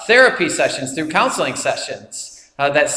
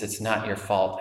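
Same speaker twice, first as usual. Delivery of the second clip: much softer and lower, tender; hushed comfort; 7 semitones lower, 9 dB quieter than usual. Retold or imitated. Sean getting closer to Will, hushed and tender. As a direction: let it land in a near hush. Counselor's final gentle repeat.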